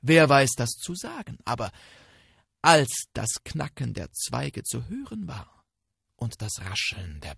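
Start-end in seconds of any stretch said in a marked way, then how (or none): none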